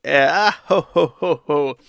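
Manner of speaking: cartoonish "ah, ho, ho, ho" fake laugh; mock absurd laugh. Streamer mimicking the actress's absurd laugh, mock ho-ho laugh. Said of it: "The laugh was so absurd".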